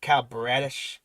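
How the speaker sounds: normal talking voice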